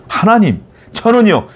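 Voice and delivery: acted reproachful call; resentful appeal. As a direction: imitate someone lodging a complaint with God. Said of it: "라고 늘 원망하는 분들이 있어요".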